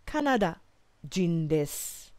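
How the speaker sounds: drawn-out careful Japanese phrase; slow model phrase. Shown as a demonstration. Teacher modelling Kanada jin desu slowly for learners. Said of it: "We could simply say".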